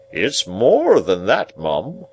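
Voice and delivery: slow, self-important confirmation; loud, stately know-all; pompous Pumblechook. Acted. Narrator voices pompous Pumblechook.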